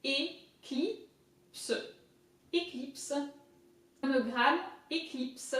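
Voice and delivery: "Eclipse" as French "ay-kleeps"; careful French drill, "Eclipse" softly sounded out; French pronunciation. Shown as a demonstration. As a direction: say "Monogram, eclipse" word by word in French. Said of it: "I is I in French".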